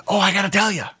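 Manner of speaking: high, gushing outburst; exclamation points. Acted. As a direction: burst out as if bursting to tell. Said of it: "Lots of exclamation points".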